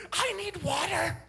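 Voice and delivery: a son desperate to delay his spanking; whiny child. Retold or imitated. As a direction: beg like a stalling little boy. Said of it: "He goes".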